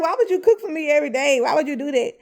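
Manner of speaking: loud, quick retold challenge; incredulous questioning. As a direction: fire the question back in mock disbelief. Retelling her incredulous question to him.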